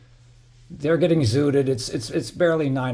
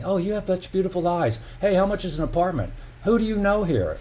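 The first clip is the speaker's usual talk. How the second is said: higher, mocking flattering voice; mock sweet-talking stranger; 6 semitones higher than usual. Retelling the intruders' flattery to viewers, mocking higher voice. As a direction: imitate a smooth-talking intruder buttering him up. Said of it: "you wouldn't believe what they were telling me".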